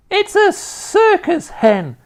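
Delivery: put-on high, drawn-out old-woman holler; Grandma's piercing cry; rooftop shout. A narrator's reading. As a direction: call it down from a rooftop, loud and slow. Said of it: "Grandma shouted from the rooftop".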